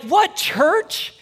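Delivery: very high, rising mock disbelief; incredulous. Society hearing it is a church, incredulous. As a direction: imitate a baffled outsider, voice jumping up. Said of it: "society's like".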